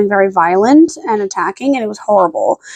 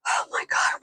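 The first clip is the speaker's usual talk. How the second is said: exasperated whispered sigh; fed-up owner whispering; 13 dB quieter than usual. Real, not acted. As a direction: breathe it out in a whisper. Exasperated at dog.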